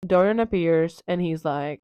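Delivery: ordinary delivery, nothing marked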